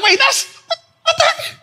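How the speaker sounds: squeaky high disbelief; mock-confused very high voice; baffled. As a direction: pretend shock at the lyrics. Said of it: "I was like".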